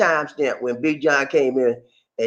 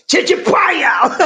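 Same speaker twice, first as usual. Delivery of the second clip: high, drawn-out shout of "Chicha paya" as one phrase; rousing catchphrase yell; put together; 9 semitones higher, 4 dB louder, much slower than usual. Shown as a demonstration. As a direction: call out "Chicha paya" joined up, stretched and high. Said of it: "Now let's put it together".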